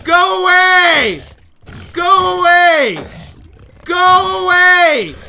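piercingly high, drawn-out scream; desperate screaming. Real, not acted. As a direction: scream it slowly at the top of your lungs. Screaming at attacker in game.

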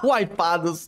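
normal talking voice

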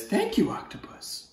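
put-on high sweet boy; polite little kid. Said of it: "the boy says".

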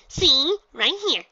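very high, loud bubbly pony voice; Pinky, exclaiming. Acted. Pinky introduces herself to the gym leader, very high and bubbly. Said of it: "she said in triumph".

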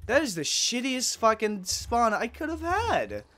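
loud, high angry rant; real exasperated shouting; furious ranting. Real, not acted. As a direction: vent fury at the game. Angry at spawn.

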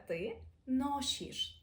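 «ти ношіш» very slowly with a soft щ; teacher shows the soft щ; soft Polish sounds. Shown as a demonstration. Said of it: "Тут вже м'якіше".

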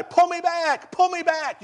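high, urgent mock yell; yelling for help. Officer on the ledge calls to fellow officers, high urgent yell. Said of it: "You can hear him yelling that on the video".

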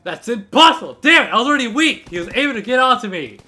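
loud, high frustrated yell; frustrated outburst. Real, not acted. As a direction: yell it like you just got cheated. Killed, frustrated.

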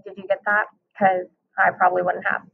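ordinary delivery

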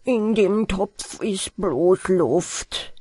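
slow mumble on "bloß Luft"; thick-tongued, slurred "bloß Luft"; mumbled. A narrator's reading. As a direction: drag out "In dem Topf ist bloß Luft" with blurred consonants. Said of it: "darauf nuschelte Peter".

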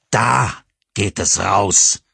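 very slow, loud cold order; cold dismissal. Acted. Father showing the driver the door, cold and slow. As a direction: point her to the door.

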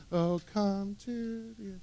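soft, slow, half-hearted worship line; timid murmured worship; timid mumble. Shown as a demonstration. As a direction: sing it like you barely mean it. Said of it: "come on, crank that up a little bit".

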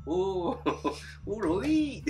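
high, laughing imitation of a rural English accent; mock rural English accent; local West Country accent. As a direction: put on a rustic English village accent. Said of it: "it's like watching, uh, Hot Fuzz".

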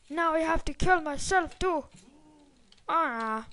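usual speaking voice

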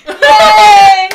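loud, high laughing cheer; excited cheer. Real, not acted. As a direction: cheer with joy. Got the good flavor.

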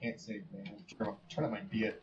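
usual speaking voice

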